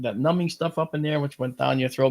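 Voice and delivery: ordinary talk, nothing marked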